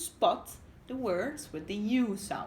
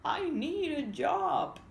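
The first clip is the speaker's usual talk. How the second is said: higher, slow voice for a lonely wife; 8 semitones higher, slower than usual. Acted. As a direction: voice her slowly, a wistful fisherman's wife. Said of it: "she said to herself".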